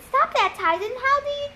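high-pitched, spooked protest; creeped out. A narrator's reading. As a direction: plead in a high, shivery character voice. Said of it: "Annabeth shivered".

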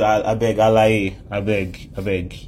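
lightly acted low-pitched brush-off; dismissive Jesus. Preacher voices Jesus brushing off Nicodemus' praise, mildly dismissive.

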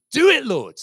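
crying out Do it, Lord at full force; a loud climax to the pleading prayer. Retold or imitated. Preacher retelling his prayer for local streets, loud plea. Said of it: "I, I'm saying".

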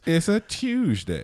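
slow, high, drawn-out put-on voice; mock grand voice. Cohost teasing the Tuesday greeting in a drawn-out put-on voice. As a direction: stretch it out like a pompous announcer. Hosts joking about Tuesday.

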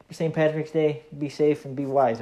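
normal talking voice

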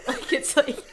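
amused, words broken up by laughter; laughing. Real, not acted. Amused about own accent slipping out.

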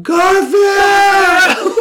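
loud, high desperate shout; calling out. Pretend. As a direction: yell for the lost cat. Jon calling for the cat.

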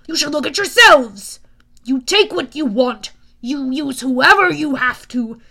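loud, deliberate condemnation; irritable god, accusing. Acted. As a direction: lecture a hero with contempt.

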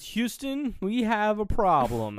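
high, slow mock announcement; hammed-up dramatic drawl; dramatic movie quote. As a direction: deliver it like a famous film line. Stu quoting the movie line.

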